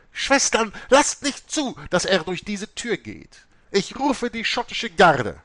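high, loud summons to the nuns; calling out. A narrator's reading. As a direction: call the sisters to block the door. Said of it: "rief andere Nonnen zu ihrer Unterstützung herbei".

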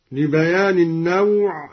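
ordinary talk, nothing marked

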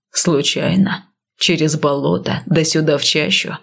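low, grim man's voice; gruff warrior, low and steady; stern northerner. Acted. Male lead voiced lower.

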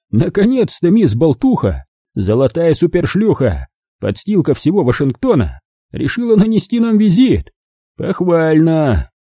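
a lightly voiced gangster savouring each insult; sarcastic. A narrator's reading. Lupo insulting his wife to her face, sarcastically. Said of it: "голосом, полным сарказма".

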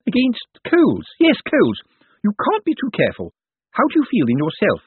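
a fussing carer covering her fear; nervous nurse. A narrator's reading. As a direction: Miss Drapes fussing over her patient, high and jittery. Nurse voice in the story.

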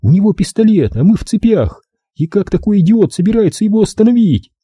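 high-pitched indignant woman; angry, high-pitched scorn; seething anger. A narrator's reading. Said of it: "Она кипела от гнева".

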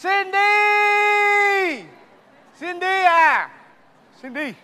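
high, loud shout for Cindy; calling out loud. Acted. Husband calling out to his ex-wife, loud shout. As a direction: yell her name across the room.

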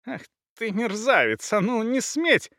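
high-pitched, strained indignation; angry snarl. A narrator's reading. Disarmed husband snarls at his attacker, angry. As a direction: protest furiously as you are overpowered. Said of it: "рычал тот".

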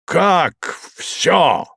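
high indignant outburst. A narrator's reading. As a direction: demand it, outraged. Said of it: "спросил он с негодованием".

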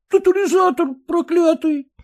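high, sobbing cry. A narrator's reading. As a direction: wail it through sobs. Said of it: "рыдая, юноша". (юноша рыдая выкрикивает)

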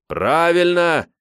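high, drawn-out shout; crowd approval. A narrator's reading. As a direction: cheer agreement from the crowd.

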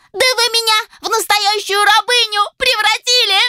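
very high, piercing squeal; shrill ultrasound. Acted. Elf shrieks at the inventor with a piercing voice. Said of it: "провизжала Моника".

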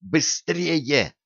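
urgent hiss. Acted. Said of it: "шипела на них Янка".

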